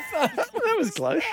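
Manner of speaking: high laughter, the words slow and stretched; laughing relief. Real, not acted. Host laughing at a near slip with high relieved laughter.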